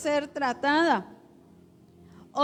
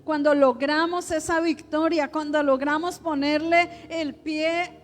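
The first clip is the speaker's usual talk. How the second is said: raised, driving preacher voice; fervent preaching; 3 semitones higher than usual. Acted. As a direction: proclaim victory with rising fervor. Preaches at full force.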